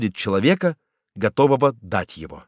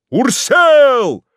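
much higher, louder yelled name; crowd yell; 11 semitones higher, 6 dB louder than usual. A narrator's reading. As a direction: yell the name at full force. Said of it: "постепенно усилившийся до крика".

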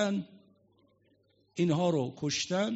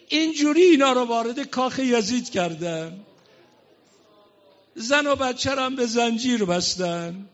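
loud, rising mourning sermon, higher and louder; full-force preaching; 6 semitones higher, 8 dB louder than usual. Acted. Preacher tells mourners of the court of Yazid, loud impassioned preaching. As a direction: drive the tale of Zaynab home at full voice.

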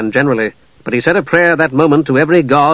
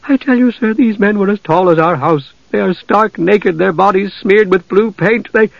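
put-on insistent farm girl; 6 semitones higher than usual. A narrator's reading. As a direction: make the governor believe her.

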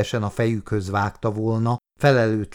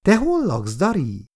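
put-on high child's question, dropping on the name; little girl; 7 semitones higher than usual. A narrator's reading. Little girl asks the old man where he lives, high child's voice. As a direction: start high, like a small girl. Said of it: "kérdezte a kislány".

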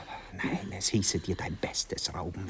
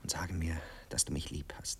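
softer, whispered plea for love; tender whisper; 5 semitones lower, 6 dB quieter than usual. Acted. Asking for love in bed.

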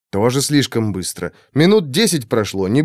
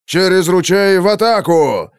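higher, louder charge order; rally the charge; battle cry; 5 semitones higher, 4 dB louder than usual. A narrator's reading.

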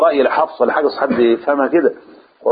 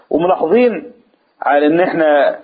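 ordinary talk, nothing marked

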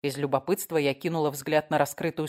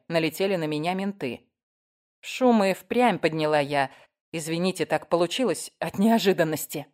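ordinary delivery

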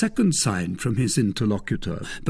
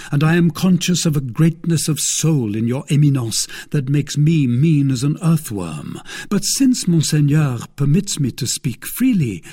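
lightly voiced young guardsman, politely declining; higher, earnest and respectful young man; D'Artagnan, deferential; 6 semitones higher, 6 dB louder than usual. A narrator's reading.

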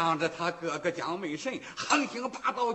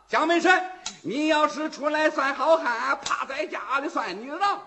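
taunting shout; 6 semitones higher, 5 dB louder than usual. Acted. Wu Song taunts the bully.

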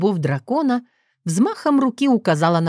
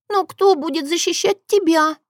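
put-on high-pitched little girl; worried child; 10 semitones higher than usual. A narrator's reading. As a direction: ask as an anxious little sister. Катя, ребёнок, спорит с сестрой. (встревоженный детский голос)

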